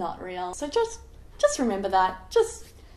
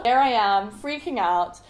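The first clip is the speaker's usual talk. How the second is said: usual speaking voice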